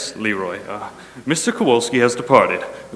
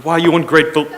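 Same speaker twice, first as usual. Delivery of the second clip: angry call; 5 semitones higher, 5 dB louder than usual. Acted. Boss yelling at departing technician.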